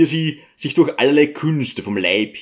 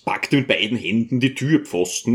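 plain reading voice

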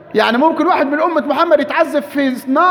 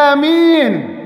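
آمين called out with a falling end; a raised pulpit آمين; loud amen; 2 semitones higher, much slower than usual. Retold or imitated. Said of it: "قال بصوت عال". (آمين بصوت مرتفع)

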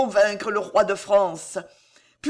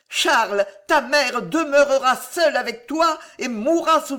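high, loud dramatic lament; impassioned angry outcry; growling anger; 6 semitones higher, 6 dB louder than usual. A narrator's reading. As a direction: declaim it with bitter, rising force. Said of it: "gronda Catherine".

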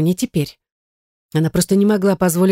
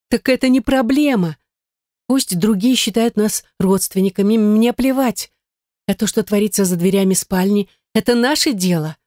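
higher-pitched, insistent female character; persuasive heroine; 5 semitones higher than usual. Acted. Gayne persuading David to ignore others, insistent. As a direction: brush the objection aside, eagerly.

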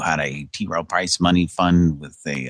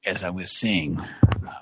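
ordinary talk, nothing marked

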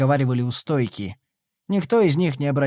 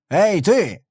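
gruff, loud hail; rude call; 5 semitones higher, 4 dB louder than usual. A narrator's reading. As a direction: bark at the stranger. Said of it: "грубо окликнул его Дегнер".

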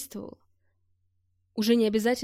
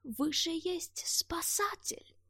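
put-on softer, slow, higher old man with broken Russian; pleading old foreigner; 7 semitones higher, 7 dB quieter, much slower than usual. A narrator's reading. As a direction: plead persistently like an old soldier. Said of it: "не унимался старик".

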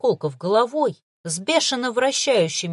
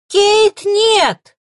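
high, loud desperate cry; trembling cry; 12 semitones higher, 11 dB louder than usual. A narrator's reading. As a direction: cry out in terror. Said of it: "дрожащий голос".

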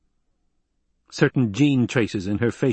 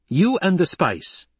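high, slow, forceful pronouncement; brooking no dispute; 7 semitones higher, slower than usual. A narrator's reading. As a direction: state it slowly with commanding weight. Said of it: "The terrifying presence of his voice brooked no dispute".

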